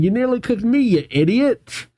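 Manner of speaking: high-pitched acted indignation; hammed-up huffy outburst; mock indignant scolding. Crystal scolds Gold, throwing potato.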